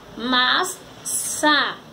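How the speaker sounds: "amassar" very slowly, a, mas, sar; sílabas separadas. Shown as a demonstration. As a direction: break "amassar" into three spaced syllables. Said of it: "Formou a palavra amassar". ("amassar" bem devagar, sílaba por sílaba)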